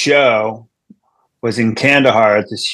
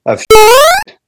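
loud punchline; 9 dB louder than usual. Acted. Punchline about the poo pond.

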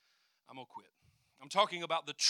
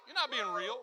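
usual speaking voice

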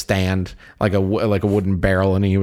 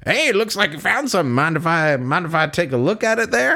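higher, wheedling cowboy voice; sweet talk; 5 semitones higher than usual. Acted. Arthur asking to see the hobo's gold, sweet talking. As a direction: coax him with put-on friendliness. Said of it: "Sweet talk".

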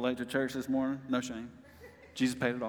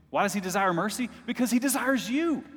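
rising fervor; 8 semitones higher, 4 dB louder than usual. Real, not acted. Preacher builds to climax.

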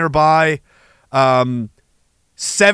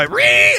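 high shrill Ree screech; mock rage screech. Online troll screeching in mock rage. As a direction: squeal like an enraged troll. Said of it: "it's just one guy".

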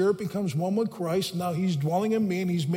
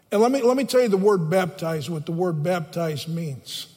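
ordinary talk

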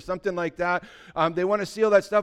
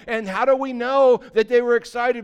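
fiery preaching; 6 semitones higher, 7 dB louder than usual. Acted. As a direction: build the crowd up with fire. Preaching at full force.

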